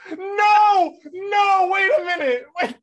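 high, loud playful shouting; frantic, high-pitched cries to stop; panicked protest. Real, not acted. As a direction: shriek in comic alarm at being caught. Playful panic at being clipped.

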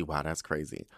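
a muttered, deflated repeat of the polite wow; fading fake wow. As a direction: let the fake praise die away under your breath. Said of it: "the whole time I'm like".

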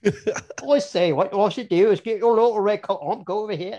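high, loud mock English accent; hammed-up, badly faked English accent. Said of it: "that's my bad English accent".

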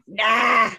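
a gruff, lightly voiced Raph; loud, bored Raph brush-off. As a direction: toss off a lazy teen "Nah". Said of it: "my Raph voice".

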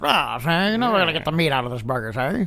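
put-on low, fast old-timey gangster talk; 1930s beat cop. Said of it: "sounds like an old-timey cop".

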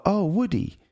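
dad doing his daughter's delighted little voice; imitating his toddler. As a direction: coo it like a small child spotting her toy. Dad imitating toddler watching Toy Story.

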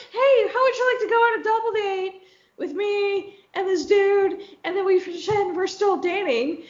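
high, sugary request to an ex; mock sweet ask. Asking her ex to pretend they still date, high and sweet. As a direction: pitch it high and innocent.

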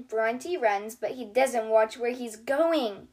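plain reading voice

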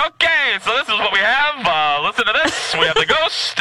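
high, quick host voice; high brisk mock broadcaster; [name] hosting. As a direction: present like a pro radio host.

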